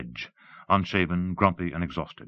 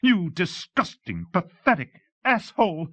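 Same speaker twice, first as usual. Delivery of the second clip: high, slow, tearful outrage; high-pitched, furious scream; screamed insult; 13 semitones higher, 4 dB louder than usual. Acted. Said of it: "she screamed, tears welling".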